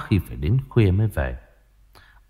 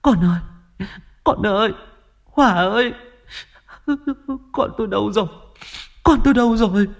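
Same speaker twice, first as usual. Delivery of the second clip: high, anguished calling for her son; mother calling her son at the pond, much higher; desperate calling; 13 semitones higher, faster than usual. A narrator's reading. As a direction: search and wail for the missing child. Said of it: "âm thanh kêu gọi thảm thiết của bà".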